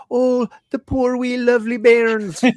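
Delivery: high, slow Scottish lilt, cooing over babies; a fond Scottish coo, pitched well up and drawn out; Scottish accent. Retold or imitated. Retelling Rain's line about the babies in a high Scottish accent. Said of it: "Had to really get that accent in there".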